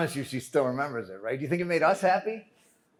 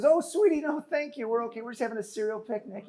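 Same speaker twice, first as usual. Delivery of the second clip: much higher, sweet polite sister voice; imitating his sister; 8 semitones higher than usual. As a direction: play the sister politely turning down money. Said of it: "She says".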